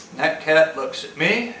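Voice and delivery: ordinary delivery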